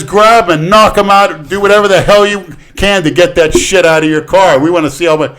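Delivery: loud, high-pitched imitation of a cop egging someone on; goading cop. Cop daring the driver to clear his car, loud and goading.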